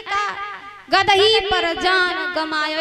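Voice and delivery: का alone, pause, then slow गदही as one word; split after का. Shown as a demonstration. Storyteller reveals the lover's donkey reading, pause after का. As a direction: stop after का, then draw out गदही पर जान गमायो. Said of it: "उसने क्या लिखा?".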